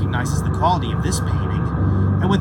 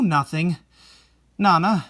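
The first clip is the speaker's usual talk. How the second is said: normal talking voice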